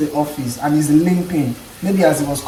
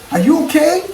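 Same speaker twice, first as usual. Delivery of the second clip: much higher, bright British check-in; British colleague's phrasing; 11 semitones higher, 4 dB louder than usual. Retold or imitated. British colleague showing what to say instead of sorry, much higher. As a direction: ask it the way the Brits do. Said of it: "You can say".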